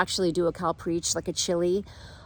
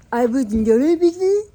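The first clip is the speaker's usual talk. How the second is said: higher, louder mock jumbled words; garbled attempt; 5 semitones higher, 5 dB louder than usual. Nervous man approaches her on plane, imitated garbled question. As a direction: imitate someone fumbling words, rising at the end. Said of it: "He could not get words out".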